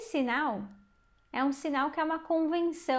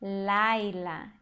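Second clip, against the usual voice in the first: Laila with a strong, drawn-out first syllable LAI; low, careful Laila, leaning on LAI; stressed-syllable demo; 4 semitones lower than usual. Shown as a demonstration. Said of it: "Preste atenção no sinal de sílaba tônica".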